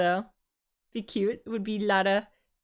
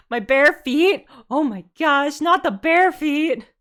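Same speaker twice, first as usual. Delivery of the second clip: gasp, then louder slow outrage; mock shocked; 5 semitones higher, 8 dB louder, slower than usual. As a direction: play up the scandalized panda. Mock outrage at feet joke.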